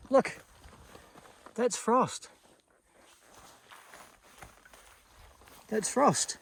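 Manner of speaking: high, bright surprise; thrilled discovery of first frost; excited. Real, not acted.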